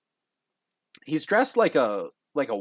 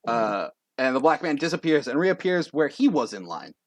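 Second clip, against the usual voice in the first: ordinary delivery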